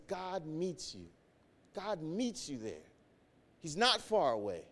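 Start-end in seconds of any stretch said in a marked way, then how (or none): 0.1-2.9 softly. Acted. Preacher softens to comfort.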